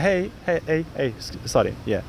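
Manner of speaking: gentle, hesitant hey said softly; careful quiet opener for a shy girl. Shown as a demonstration. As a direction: approach her quietly, as if not to scare a shy girl. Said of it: "I'm gonna switch my character".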